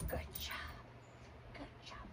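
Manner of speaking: soft whispered good job; whispered praise. Real, not acted. Praising puppy quietly.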